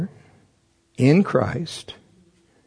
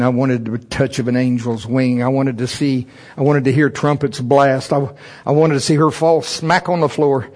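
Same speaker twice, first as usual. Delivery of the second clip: normal talking voice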